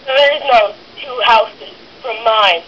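ordinary talk

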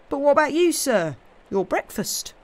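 lightly voiced servant pressing his question; concerned servant. Tom asks after his master's breakfast, concerned.

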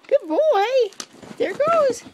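high, loud dog praise; excited praise. Real, not acted. Owner cheering on good boy, excited.

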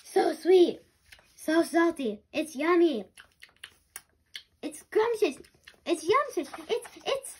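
loud, high gushing praise; loud raving. Acted. Narwhal raving about its first cookie: loud excited gushing. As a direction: gush over every bite.

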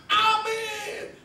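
high, drawn-out crowd "amen"; long high-pitched cry of assent; congregation's amen. Retold or imitated. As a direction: call it out like a whole room answering. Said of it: "Semua guru-guru sekolah minggu berkata".

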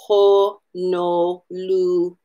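"Honolulu" slowly, every O fully rounded. Shown as a demonstration. Teacher modelling the Hawaiian way to say Honolulu. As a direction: draw out "Honolulu" with round O sounds. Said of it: "We need to round out that O".

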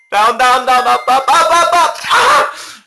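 high, loud calls building to a shriek; frantic excited calling. Real, not acted. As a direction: call out each move as it happens. Steering the bird.

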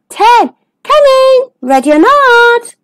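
high, drawn-out sing-song call. Acted. Seeker calls to hiding friends, high drawn-out call.

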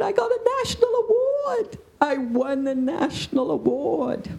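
high, near-tearful cry of joy, then slowing; overjoyed announcement. Retold or imitated. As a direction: blurt out the big news, half shouting, half crying. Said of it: "She said".

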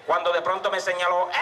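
ordinary talk

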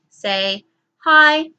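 put-on hi called out loudly for kids to echo; loud, cheerful call of hi; loud model. Shown as a demonstration. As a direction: call out hi for the class.

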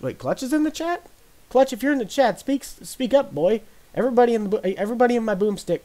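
high, excited call to a friend in chat. Real, not acted. Streamer excitedly calls a friend to speak up in chat.

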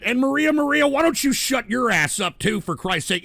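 angry. Real, not acted. Host telling Maria in chat to shut up, angry. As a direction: snap angrily at a listener. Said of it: "I'm a little angry".

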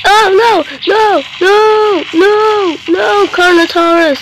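high, loud shrieked no's; terrified screams. Real, not acted. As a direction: scream no in terror. Carnotaurus attacks him.

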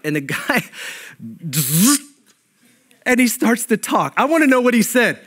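ordinary talk

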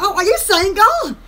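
ordinary delivery

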